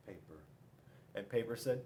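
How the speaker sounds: plain reading voice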